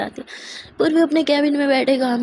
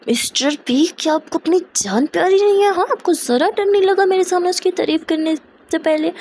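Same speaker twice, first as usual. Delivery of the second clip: jealous scolding; 6 semitones higher than usual. Acted.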